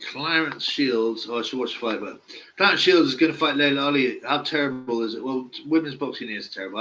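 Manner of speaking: usual speaking voice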